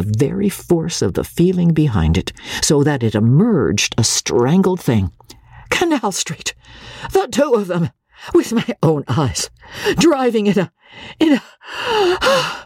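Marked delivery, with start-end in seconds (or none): 5.7-12.7 distraught. Acted. Parthy's distressed outburst.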